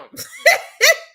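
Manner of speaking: shrieking laugh; high squealing cackle. Real, not acted. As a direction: burst out cackling. Laugh heard.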